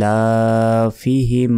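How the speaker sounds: ইলাফিহিম slow, long ee and laa; measured chant of ইলাফিহিম; tajweed elongation. Shown as a demonstration. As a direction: hold the first ee and the laa of ইলাফিহিম. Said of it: "লাতেও এক second টান হবে".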